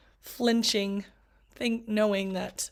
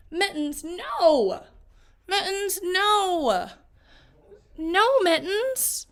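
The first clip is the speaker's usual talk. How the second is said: loud, high, rising scolding; scolding shout; 6 semitones higher, 4 dB louder, much slower than usual. Acted. Girl at the end of her rope shouting at her pet. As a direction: yell at the pet to stop.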